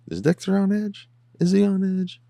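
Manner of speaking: high teasing sing-song; teasing falsetto imitation of the therapist; mocking therapist.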